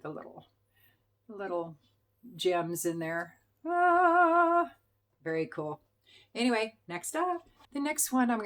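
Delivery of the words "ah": high, loud, held-out "aah"; loud drawn-out awed ah; admiring "ah". Real, not acted. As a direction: ring out a long, high ah of wonder. Admiring gems with a sung-out ah.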